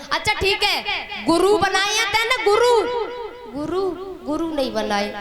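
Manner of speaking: high, teary catch on Guru; tearful stammer. Acted. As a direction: falter over Guru, Guru as tears rise. Gopi stammers, close to tears. (रोते हुए अटकना)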